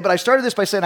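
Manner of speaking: ordinary talk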